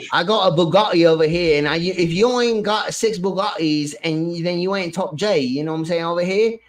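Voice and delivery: lightly put-on British douche voice; British accent. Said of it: "getting close to being a douche nozzle British".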